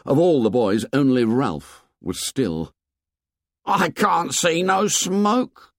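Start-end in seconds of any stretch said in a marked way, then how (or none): 3.6-5.7 incredulous. A narrator's reading. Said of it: "said Piggy incredulously".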